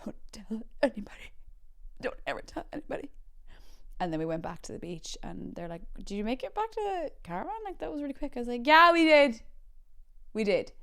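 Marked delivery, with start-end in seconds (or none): 0.0-3.1 hushed, traumatised secrecy; shell-shocked whisper. Retold or imitated. Kid walking back to mom, shell-shocked whisper. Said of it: "like, shell shocked". 6.0-8.3 high, light questioning voice; curious relatives. Retold or imitated. Family on beach asking returning kid, high-pitched curiosity. 8.6-9.4 loud, high insistence; overeager cover-up. Retold or imitated. Kid covering up to family, loud forced assurance.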